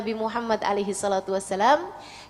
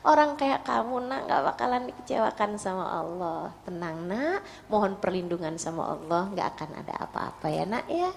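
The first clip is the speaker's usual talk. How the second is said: soft, lowered, comforting voice; soothing mother; 3 semitones lower, 4 dB quieter than usual. Acted. Mother reassures her scared child that Allah protects, softly soothing. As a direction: hush a frightened child gently.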